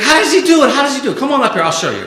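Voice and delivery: loud, high hammed-up host hype; high, loud mock hype voice; mock excited showman. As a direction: pump up the crowd like a carnival barker. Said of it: "He's like".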